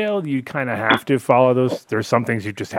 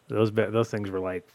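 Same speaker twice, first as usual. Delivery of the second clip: ordinary delivery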